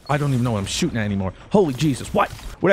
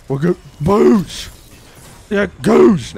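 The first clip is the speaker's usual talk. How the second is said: loud, drawn-out victory whoop; excited shout; 6 semitones higher, 9 dB louder, slower than usual. Real, not acted. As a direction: cheer the kill loudly. Celebrating kill, loud.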